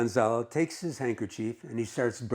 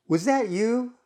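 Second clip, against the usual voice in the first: retort; 10 semitones higher, 4 dB louder than usual. Acted. Man retorts to teasing remark.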